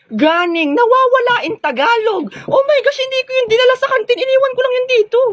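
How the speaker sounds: shrieking high, frantic about the lost phone; a spoiled classmate shrieking that her phone is gone; hysterical panic. Acted. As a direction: squeal it out in a hammed-up panic. Discovers her phone is missing.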